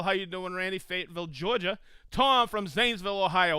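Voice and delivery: ordinary talk, nothing marked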